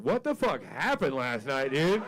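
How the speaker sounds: slow, loud, high mock dog voice; dog waking up. The dog asks what happened last night, slow and loud. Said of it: "she's like".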